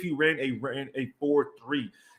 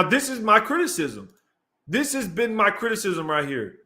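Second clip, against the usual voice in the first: ordinary talk, nothing marked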